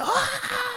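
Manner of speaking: long, very high cheer; imitated crowd scream. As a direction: mimic a concert crowd shrieking back.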